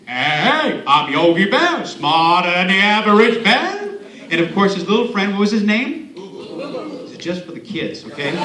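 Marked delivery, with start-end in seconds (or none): 0.1-4.0 cocky cartoon bear voice; Yogi Bear. Speaker shows kids his favorite cartoon's attitude, cocky bear voice. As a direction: swagger through it like a boastful cartoon bear. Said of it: "Yogi always had that attitude".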